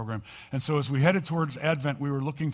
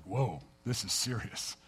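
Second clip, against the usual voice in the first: awed whoa; 4 semitones lower, 7 dB quieter than usual. Retold or imitated. Boy staring at grandpa's feet.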